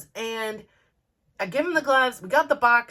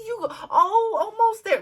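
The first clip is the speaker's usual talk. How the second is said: teary cheering; 11 semitones higher, 3 dB louder than usual. Retold or imitated. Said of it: "I am really invested in this".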